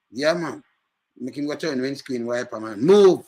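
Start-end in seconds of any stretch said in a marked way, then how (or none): 2.8-3.2 sudden high loud shout of move; shouted order; loud command. Retold or imitated. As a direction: snap the order hard. Acting out crash, ordering.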